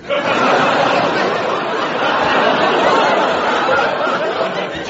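breathy breaking-up laughter; real corpsing giggle; long giggle fit. Real, not acted. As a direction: laugh helplessly through the line. Long laugh fit after aside.

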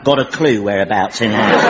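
wandering fake English accent, low; unique accent. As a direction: put on a dodgy English accent. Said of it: "it has this unique accent".

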